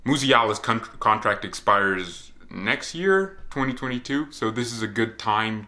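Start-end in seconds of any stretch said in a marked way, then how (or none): none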